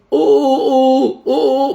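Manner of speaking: put-on high, slow, playful cock-a-doodle for a child; cheerful sing-song rooster call; playful rooster crow. Shown as a demonstration. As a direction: crow it like a game for a little boy. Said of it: "بأسلوب فيه مرح".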